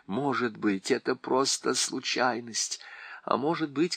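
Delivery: usual speaking voice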